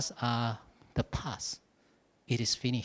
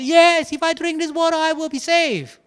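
euphoric cry; 12 semitones higher, 7 dB louder than usual. Retold or imitated. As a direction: scream it out in triumph. Jumping all around.

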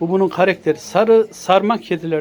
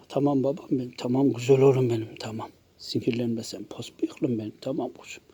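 lower, softer tender coaxing; soft soothing; 7 semitones lower, 9 dB quieter than usual. Real, not acted. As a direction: hush the cat down gently. Calming the cat softly.

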